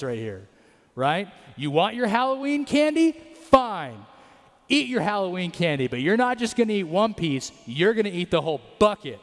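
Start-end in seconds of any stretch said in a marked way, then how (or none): 1.6-9.2 put-on high, loud, sarcastic parent scolding a child; fed-up parent. Parent scolding kids over Halloween candy, fed-up and sarcastic. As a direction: give in with a mocking threat.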